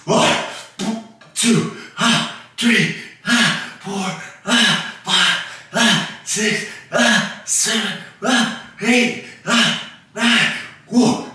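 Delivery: strained counting mid-rep; doing push ups. Real, not acted. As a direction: push the words out under effort. Counting reps mid push-up set.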